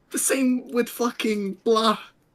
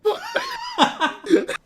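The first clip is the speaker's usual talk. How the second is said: laughing; much slower than usual. Real, not acted. Player laughing in disbelief.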